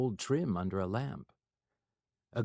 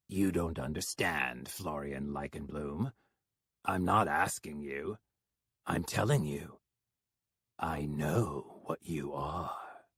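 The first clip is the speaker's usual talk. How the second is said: low, cold threat; quietly menacing stranger; Johann, low and menacing; 6 semitones lower, 3 dB quieter than usual. Acted.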